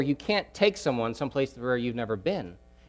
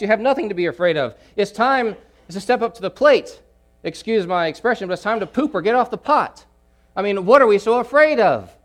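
loud, higher, driving challenge; preaching at full force; 7 semitones higher, 7 dB louder than usual. Acted. Preacher urging the congregation to act, full force. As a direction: push the church to step up.

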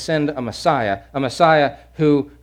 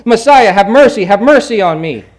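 loud, higher desperate cry; shouting for mercy; 6 semitones higher, 10 dB louder than usual. Acted. Blind beggar shouting over the crowd, loud and desperate. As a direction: cry out, refusing to be silenced. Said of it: "But he shouted more".

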